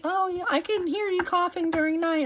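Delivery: high, light imitation of mom; a quick mock of mom's voice; imitating mom. Mom quoted about night coughing.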